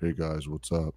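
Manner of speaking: deep modulated voice, like a broken voice changer. Retold or imitated. Kevin greeting the crew through a broken voice modulator, deep and eerie. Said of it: "he literally just goes".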